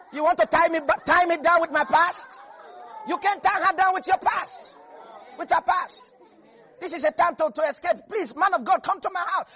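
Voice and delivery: a freed woman begging the man of God; voicing Rahab.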